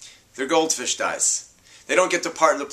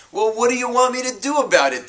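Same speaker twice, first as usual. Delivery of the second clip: exasperated, higher dismissive dad; irritated dad; 10 semitones higher, 4 dB louder, faster than usual. Acted. Father brushing off his crying daughter, exasperated. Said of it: "Dad says".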